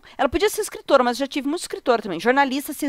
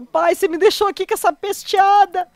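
higher, mock-whiny son voice; voz do filho; 8 semitones higher, 3 dB louder than usual. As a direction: whine it like a sulky teen son. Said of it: "e falou". (imitando o filho reclamando)

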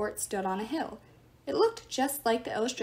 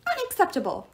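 high indignant shriek; shrill outraged cry of a statue god; 8 semitones higher, 4 dB louder than usual. Acted. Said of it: "he shrieked".

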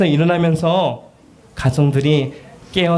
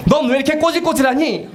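higher, mock-disgusted mother; scolding parent; 11 semitones higher than usual. Mother scolding a grubby child, mock disgust. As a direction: sneer at the scruffy kid.

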